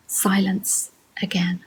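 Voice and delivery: soft, slow closing line; haiku reading. Acted. Teacher ending the frog haiku, hushed poetry reading. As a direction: let it fall quiet and slow.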